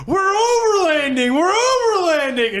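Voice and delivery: high, loud, drawn-out yell; screaming. Retold or imitated. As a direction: belt it out in a thrill. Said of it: "and I'm screaming".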